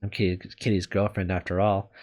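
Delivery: usual speaking voice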